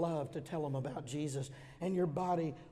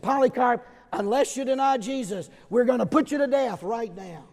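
louder, a stern hostile command; threatening officials; 4 semitones higher, 9 dB louder than usual. Acted. Roman authorities threatening Polycarp, loud and stern. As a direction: threaten him with death. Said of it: "and they said".

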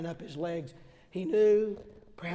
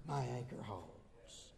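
softer and slow, settling; 8 semitones lower, 12 dB quieter, slower than usual. Acted. Voicing Job's answer to the congregation, soft and slow. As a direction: lay the refrain down gently. Job's quoted reply ends softly.

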